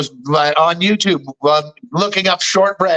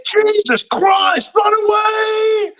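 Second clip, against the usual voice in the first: very high, drawn-out comic yell; mock panicked shout; 14 semitones higher, much slower than usual. Fleeing the savage bunny, mock panicked shout. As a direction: holler it like a hammed-up retreat. Python killer rabbit scene re-enacted.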